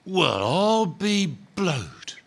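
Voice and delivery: slow, drawn-out astonished exclamation; a lightly voiced grumbling ghost; gruff ghost. Big ghost astonished to see the bright spirit, drawn out. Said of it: "said the ghost".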